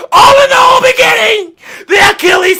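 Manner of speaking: high, loud, near-tearful cry; triumphant yell. Real, not acted. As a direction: shout it like you called it all along. Reliving his correct prediction.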